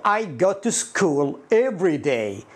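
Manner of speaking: slow, drawn-out English example; example sentence. Shown as a demonstration. Teacher models a dictionary example for students, slow and drawn out. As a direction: say it word by word, stretched out. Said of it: "فتجد إنه مثلًا يقول".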